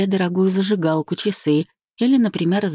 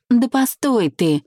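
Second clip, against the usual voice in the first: higher, drawn-out mild panic; flustered alarm; 7 semitones higher, slower than usual. A narrator's reading. Marina stops Maxim from hanging up, alarmed. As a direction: call them back in sudden worry. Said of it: "мгновенно всполошилась Марина".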